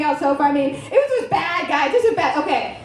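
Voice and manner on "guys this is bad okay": ordinary delivery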